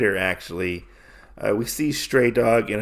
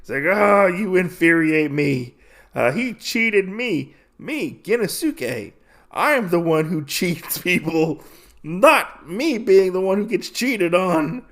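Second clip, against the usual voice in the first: higher-pitched mock outburst, arms in the air; retold angry shout; 7 semitones higher than usual. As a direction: play it as a half-hammed indignant rant.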